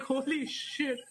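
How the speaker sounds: mock-stunned at the news; retold amazement. Retold stunned reaction to finished manga.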